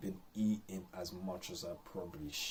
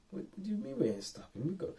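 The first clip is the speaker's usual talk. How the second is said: much higher, fast and insistent; hype inner voice; 12 semitones higher, faster than usual. Retold or imitated. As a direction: push him on, no stopping allowed. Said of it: "this little dialogue going in my head".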